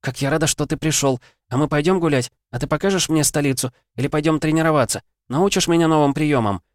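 put-on high, eager girlish rapid chatter; bubbling over with excitement, questions tumbling out; excited chattering. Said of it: "Мика уже тараторила без остановки".

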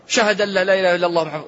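fast, gabbled shahada; rushed. Retold or imitated. Mocking those who hurry it, fast gabble. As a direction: rattle it off in a rush. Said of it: "بعض الناس يعجل يقول".

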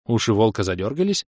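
plain reading voice

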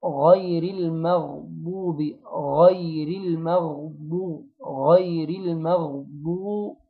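غير المغضوب with a thin م and a heavy, rounded ضاد; slow recitation. Shown as a demonstration. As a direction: draw out المغضوب, lips rounded on the ض. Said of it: "لازم أضم فيها الشفتين كويس".

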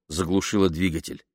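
ordinary delivery, nothing marked

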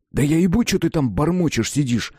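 irritated retort; snapping back. A narrator's reading. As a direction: snap back at him. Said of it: "огрызнулся я".

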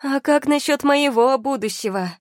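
high, loud, anguished demand; shouting. A narrator's reading. Wife cuts off husband's talk of kingdom, shouting. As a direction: cry out for your own future. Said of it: "прокричала Тия".

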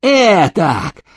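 lightly voiced gloating blows; a hint of a mocking character voice; character voiced while beating. A narrator's reading. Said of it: "приговаривая". (слегка изображённый голос героя)

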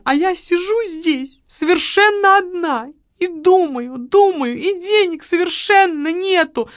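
high, loud complaining woman; aggrieved widow. A narrator's reading. Widow complains to the marquis, high and loud. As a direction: pour out her grievances.

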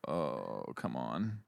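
low, soft groaned protest; imitating an exasperated man; acted weary exasperation. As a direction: drop low and quiet, utterly fed up. Magnum's acted weary reaction.

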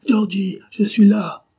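high, loud desperate cry; dying man shouting high and loud. A narrator's reading. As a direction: call out across the square with all his strength. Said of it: "cria-t-il de toute la force de ses poumons".

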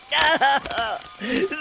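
bitter cackle. Acted. Cornelius laughs in his rant.

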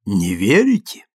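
haughty question, pitch swooping upward. A narrator's reading. Guest challenges the doubting narrator, haughtily. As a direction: ask it with lofty disdain. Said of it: "высокомерно спросил он".

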